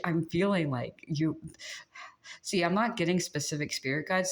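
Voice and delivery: normal talking voice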